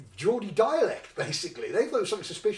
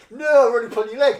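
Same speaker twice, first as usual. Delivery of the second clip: loud, laughing through the punchline; laughing reveal; 5 semitones higher, 12 dB louder than usual. Retold or imitated. Officers laughing as they tell him it's a joke, loud and laughing. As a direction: crack up as you let him off the hook.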